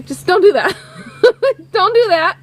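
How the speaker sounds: scold, cracking up as you say it; amused imitation of a bossy producer; laughing mock scolding.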